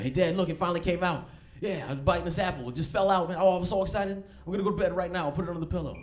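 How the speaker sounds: put-on a child proudly showing his lost tooth; excited little boy. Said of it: "he's like".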